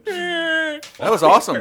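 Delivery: squealing laugh through words. Real, not acted. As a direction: laugh till it cracks high. Laughing hard at the song.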